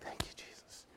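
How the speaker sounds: breathless unvoiced whisper of relief; whispered thanks. Isaac whispering thanks to Jesus after being spared. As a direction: whisper it like a rescued boy. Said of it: "Isaac's like".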